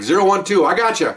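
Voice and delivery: quick acted radio exchange; radio call-out. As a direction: call the numbers out as over the radio. Acts radio exchange with helicopter.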